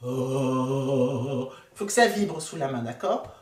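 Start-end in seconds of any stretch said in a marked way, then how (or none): 0.0-1.5 long, very low vibrating "oh"; lowest sound. Shown as a demonstration. Coach has hand on chest, deep held "oh". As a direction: drop to your deepest steady note. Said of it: "le son le plus grave que vous pouvez".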